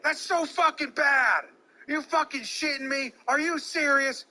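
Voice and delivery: loud, high, outraged yelling; furious rant. Acted. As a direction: tear into the umpire at full volume. Mock player rage at umpire.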